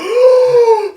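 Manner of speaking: sharp high gasp of delight; excited gasp. Retold or imitated. A starstruck gasp, reliving seeing the software creator. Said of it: "I was like".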